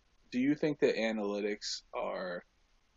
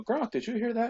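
pitched way up and louder, hyped; mock Tom Brady; 13 semitones higher, 5 dB louder than usual. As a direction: playfully imitate a fired-up quarterback calling his buddy. Retelling Brady calling Gronk.

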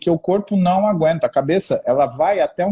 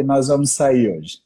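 lower, slow, decisive; firm announcement; 5 semitones lower, slower than usual. Retold or imitated. Husband tells his wife they are going out tonight, low and slow. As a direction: lay it down like a settled plan.

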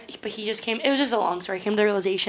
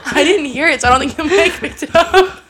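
high, loud, giggling through every word; squeaky with laughter; laughing hard; 6 semitones higher, 6 dB louder than usual. Real, not acted. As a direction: reassure a friend while cracking up. Reassuring co-host while cracking up.

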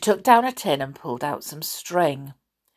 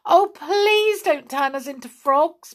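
put-on a mild, higher pleading girl; begging; 9 semitones higher, 3 dB louder than usual. A narrator's reading. Peggy begs the wizard to spare them, mild pleading. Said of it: "begged Peggy".

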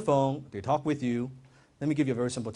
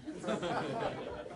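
laugh; 11 dB quieter than usual. Acted. Mocking his own eager salesman.

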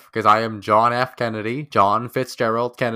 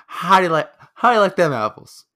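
put-on louder, higher Boston accent; 6 semitones higher, 5 dB louder than usual. Friends trading impressions, a louder Boston accent. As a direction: punch it like a Boston tough guy.

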